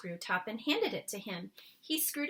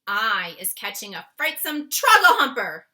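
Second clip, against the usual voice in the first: loud alarmed declaration; loud alarmed giant's cry; 14 dB louder than usual. A narrator's reading. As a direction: declare it in horror. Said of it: "he cried".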